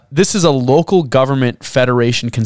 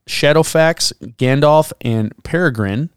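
usual speaking voice

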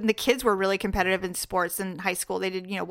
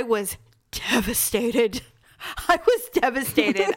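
laughing. Real, not acted. Mock devastation laughing.

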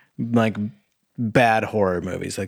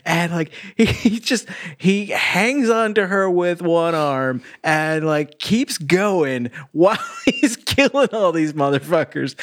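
laughing; 9 semitones higher than usual. Real, not acted. Laughing while retelling a wild scene.

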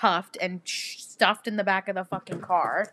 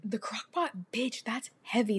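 hushed emphatic whisper; 7 dB quieter than usual. Real, not acted. Marveling at George throwing a heavy crock pot, whispered. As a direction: whisper it like a scandalized aside.

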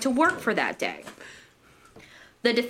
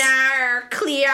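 higher, drawn-out fake Australian vowels; mock Aussie accent; 4 semitones higher, 5 dB louder, slower than usual. Host quoting a TV show, mock Aussie accent. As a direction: wail it in a hammed-up Australian accent.

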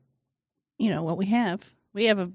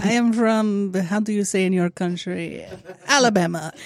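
mock Russian accent. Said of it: "pick up this crazy accent".

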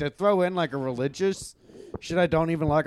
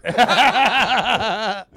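loud laugh; 6 semitones higher, 7 dB louder than usual. Real, not acted. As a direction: burst of laughter. Laughs at own punchline.

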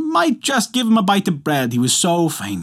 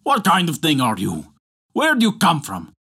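rough. Acted. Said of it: "spoke roughly to him".

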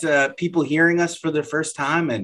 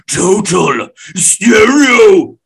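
high, slow hammed-up clown voice with a giggle; carnival clown voice; 3 semitones higher, 7 dB louder, much slower than usual. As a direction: stretch it out like a goofy circus clown, then giggle. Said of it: "Like a carnival clown".

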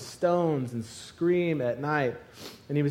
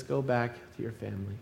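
quiet, tender; 6 semitones lower, 9 dB quieter, faster than usual. Acted. Jesus sends man home softly.

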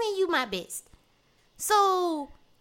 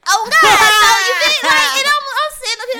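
much louder and higher, almost shouting; shouting oh God; loud exclaim; 8 semitones higher, 10 dB louder than usual. Real, not acted. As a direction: blurt it out loud.

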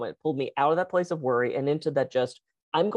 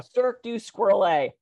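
"Cirque du Squirrel A" said higher with relish; delighted repeat; 5 semitones higher than usual. Shown as a demonstration. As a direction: savour the pun, pleased. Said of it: "Oh, perfect".

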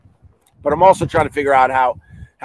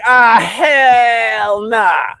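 drawn-out high "hell nah" in disbelief; slow, loud disbelief; exasperated; 8 semitones higher, 4 dB louder, much slower than usual. Retold or imitated. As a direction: stretch it out, fed up and shocked. Dog attack, exasperated outburst.

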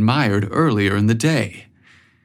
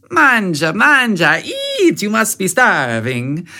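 high, insistent Italian aunt urging food; heavy Italian accent; 9 semitones higher than usual. A narrator's reading. Aunt pushing food on nephew, heavy Italian accent. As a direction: coax him to eat, Italian accent. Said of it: "her heavy Italian accent".

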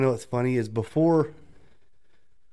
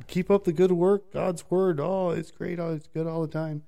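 high, bright fake-cheerful imitation; sing-song corporate cheer; mock cheerful coworker; 8 semitones higher than usual. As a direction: squeak out hollow praise.

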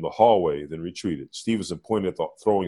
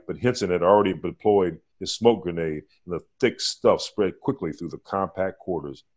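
ordinary delivery, nothing marked

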